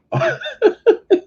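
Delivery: a high, short burst of laughter; amused laugh. Real, not acted. Reviewer describing Sean P's funny video, high laugh.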